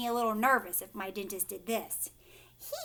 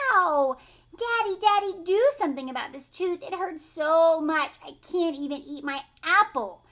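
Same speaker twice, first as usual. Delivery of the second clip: put-on loud, pleading childlike complaint; yelling in pain; 4 semitones higher, 7 dB louder than usual. Hurt boy begging Daddy to fix his tooth, yelling. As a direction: yell for help over a sore tooth. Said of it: "and yelled".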